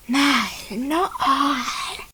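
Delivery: slow drawn-out snake voice with a chuckle; sly snake. Acted. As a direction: tease like a sneaky snake. Snake answers.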